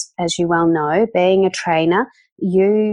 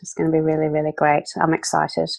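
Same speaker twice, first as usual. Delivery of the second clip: usual speaking voice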